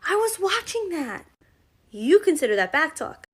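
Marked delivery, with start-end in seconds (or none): 0.1-1.3 put-on high, put-upon kid protesting; child complaining about the TV; whiny kid. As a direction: whine it like a kid whose show got cut off. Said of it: "The kid goes".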